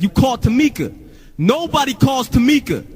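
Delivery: hammed-up high-pitched outrage; aghast label guy. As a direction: imitate a dude appalled at a huge blunder.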